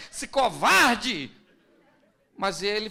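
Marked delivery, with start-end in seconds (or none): 0.1-1.3 slow, loud indignant jab; wife snapping at her husband; angry retort. Acted. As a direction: spit it at him. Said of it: "Que ela fala".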